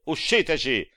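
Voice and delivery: high, loud barked command; shouted order. A narrator's reading. The boatman ordering them out, high loud shout. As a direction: bellow them out of the boat. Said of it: "gridò".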